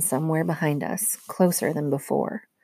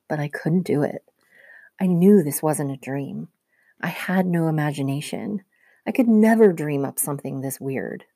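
plain reading voice

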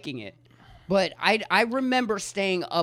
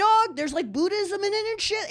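excited. Real, not acted. High excited exclamation.

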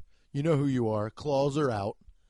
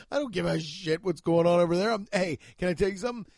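ordinary delivery

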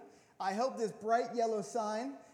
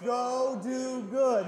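loud, very slow group command; mock-divine chorus, loud and drawn-out; loud like God; 5 dB louder, much slower than usual. Shown as a demonstration. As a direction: boom it out slowly like God. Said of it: "you're talking like God, so you gotta talk really loudly".

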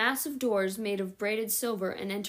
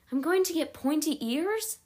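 shocked; 7 semitones higher than usual. Acted. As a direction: blurt it out in disbelief. Sophie shocked about pointy ears.

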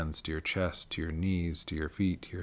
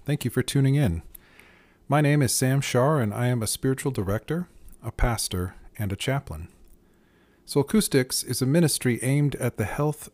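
louder, lifted scripted welcome; podcast intro read; 4 semitones higher, 6 dB louder than usual. Acted. Host welcoming listeners to the podcast, projected intro read. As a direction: read it out brightly as a show opener.

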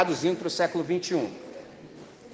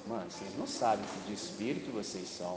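calm rebuke; 9 semitones lower, 13 dB quieter than usual. Retold or imitated. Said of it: "Jesus só falou assim".

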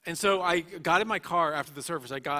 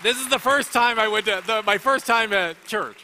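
overwhelmed excitement; 7 semitones higher, 8 dB louder than usual. Real, not acted. Overwhelmed recalling conversion.

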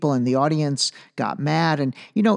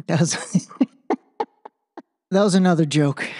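laughing through the words, a little higher; 4 semitones higher than usual. Real, not acted. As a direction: chuckle while admitting the joke. Joking about Pence's supporters.

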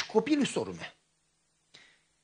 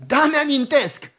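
1 emphatic eager yes; 7 semitones higher, 8 dB louder, much slower than usual. Retold or imitated. Dialog cu tatăl, tânăr.

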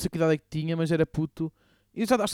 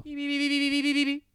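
imitated shop alarm beeping; 6 semitones higher, 4 dB quieter than usual.

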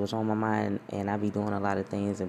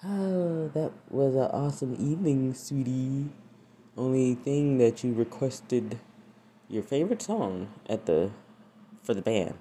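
Fresh Prince dad; 5 semitones higher, 3 dB louder than usual. Retold or imitated. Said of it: "He said".

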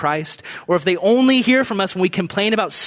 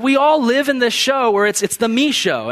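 ordinary talk, nothing marked

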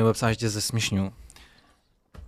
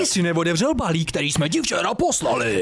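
slow, higher mock old man; a slow, higher put-on old man caller; 4 semitones higher, slower than usual. Friend mimics the old man caller complaining about the parcel, slow and higher. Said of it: "zněl jak takový děda".

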